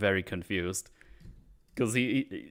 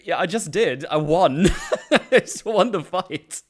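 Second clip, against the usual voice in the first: loud laugh through the words; crowing between laughs; 8 semitones higher, 8 dB louder than usual. Retold or imitated. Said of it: "I was like".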